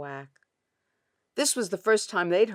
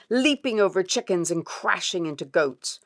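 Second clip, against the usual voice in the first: ordinary delivery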